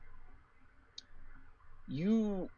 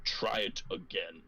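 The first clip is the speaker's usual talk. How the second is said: low, gruff order; lowered, measured gruff voice; 4 semitones lower than usual. Acted. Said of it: "the gruff voice answers".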